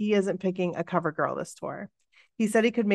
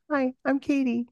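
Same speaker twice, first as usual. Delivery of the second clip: put-on small, high, childlike voice; little voice; 5 semitones higher than usual. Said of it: "I say in this little voice".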